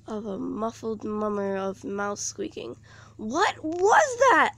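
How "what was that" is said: high, slow frightened shout; startled cry that jolts the listener; a scared girl shouting in the dark. A narrator's reading. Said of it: "Elizabeth shouted".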